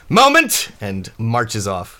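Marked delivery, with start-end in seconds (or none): 0.1-0.7 high, loud one-word holler; Quint calling out. Acted. Quint answers the banging before marching off, loud holler. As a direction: bellow it out, pitched way up. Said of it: "And Quint says".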